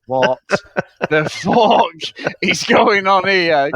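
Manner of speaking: slow, drawn-out mock disbelief. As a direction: stretch it out like you can't believe it. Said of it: "And I'm like".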